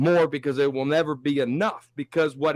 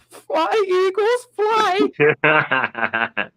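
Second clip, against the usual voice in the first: high, loud mocking chant; little voice in his head; 5 dB louder, much slower than usual. Host mocks rival fan's inner voice, high taunting little voice. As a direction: taunt in a piercing, drawn-out little voice. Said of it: "that little voice in his head saying".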